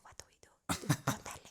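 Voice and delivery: hushed secretive whisper. Retold or imitated. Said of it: "whispers to me".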